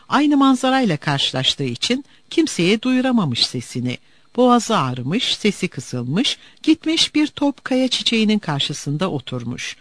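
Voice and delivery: ordinary delivery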